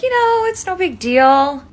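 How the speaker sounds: self-assured denial in a lifted voice; breezy high brush-off. Retold or imitated. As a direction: brush it off like it's nothing, voice lifted. Acted past self brushing off.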